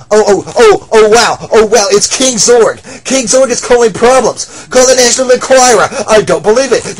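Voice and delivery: loud, high sarcastic astonishment; loud mocking gasps. Bystander loudly mocking King Zorg's trouble. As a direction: gush with fake shock. Crowd mocks King Zorg.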